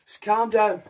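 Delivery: usual speaking voice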